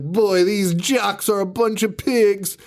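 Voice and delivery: high, drawn-out mock complaint; grumbling character quote. Retelling the guy's line as he starts cleaning, mock grumbling. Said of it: "And he's like".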